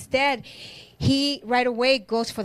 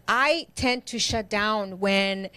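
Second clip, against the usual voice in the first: ordinary talk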